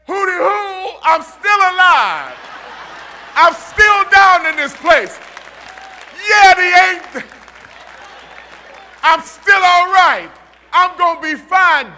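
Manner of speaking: loud slow playful street shout; street-slang Daniel. Retold or imitated. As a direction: holler it like a cocky kid from the east side. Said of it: "forgive me when I say this".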